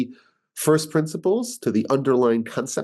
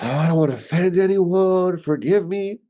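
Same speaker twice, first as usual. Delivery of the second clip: higher, mocking a timid, apologetic person; 6 semitones higher than usual. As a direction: parody someone afraid to offend. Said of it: "But that is".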